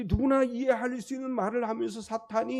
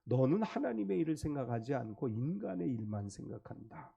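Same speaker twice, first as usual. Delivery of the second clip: low, soft grave rebuke of 너는 하나님의 일을; Jesus rebuking Peter, lower and softer; stern rebuke; 6 semitones lower, 8 dB quieter than usual. Retold or imitated. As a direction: quietly reprove him. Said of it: "예수님 베드로를 책망하셔요".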